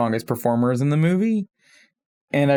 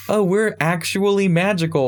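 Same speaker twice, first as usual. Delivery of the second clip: mocking Disney; 6 semitones higher than usual. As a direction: sneer it like a smug corporate slogan. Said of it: "I kind of hate their whole".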